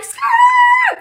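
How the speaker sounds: very high, loud screeching tires noise; mock screech of tires speeding off. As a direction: shout a squealing car peel-out. Racing off in the car.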